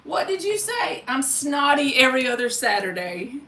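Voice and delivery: ordinary delivery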